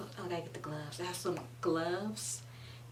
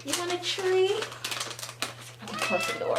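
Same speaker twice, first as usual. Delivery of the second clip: much higher coaxing; coaxing pet voice; 11 semitones higher, 3 dB louder than usual. Real, not acted. Owner coaxes her cat with a treat, high voice. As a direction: lure the kitty sweetly.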